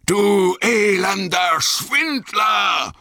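high, drawn-out furious roar; wütendes Brüllen. A narrator's reading. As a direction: bellow the accusation slowly in rage. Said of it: "brüllte er". (wütend und gedehnt gebrüllt)

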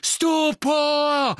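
high, loud incantation cry; shouted spell. A narrator's reading. Harry firing at Bellatrix's back, shouting. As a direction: hurl the spell at full voice. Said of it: "rief Harry".

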